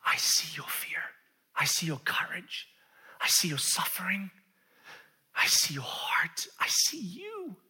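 slow, whispery sincerity; hushed, intimate compassion; tender reassurance. Acted. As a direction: breathe each phrase out gently. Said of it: "He's just saying".

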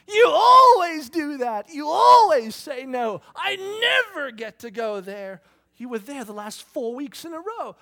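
loud, high, slow shouted complaint; kids shouting back. Retold or imitated. Kids shouting at dad who refused them. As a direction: hurl it back like a furious kid. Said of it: "they go".

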